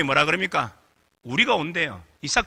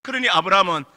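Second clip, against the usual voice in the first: ordinary delivery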